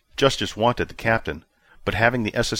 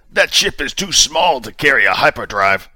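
a hint of a stern sheriff, higher and louder; clipped commanding officer tone; hint of a stern commander demanding answers; commander Justice, lightly voiced; 6 semitones higher, 7 dB louder than usual. A narrator's reading. Commander argues.

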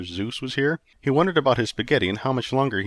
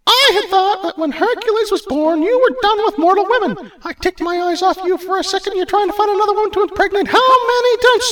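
very high, loud scolding goddess; jealous wife; 9 dB louder than usual. Acted. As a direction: berate a wandering husband. Hera voice.

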